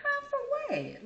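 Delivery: very high, slow, alarmed question; alarmed kid. Retold or imitated. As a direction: ask it scared and drawn out. Scared kid asking.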